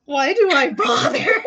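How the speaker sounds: loud high cry of despair, laughing. Real, not acted. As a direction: wail it in comic despair. GM despairing at rolls.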